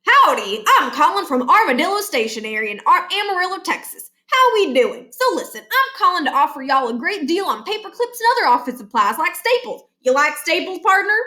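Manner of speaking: high, drawling Texas twang, selling hard; a cheery Texan cold-caller pitching paper clips; Southern salesman accent, upbeat; folksy Texas salesman. Acted.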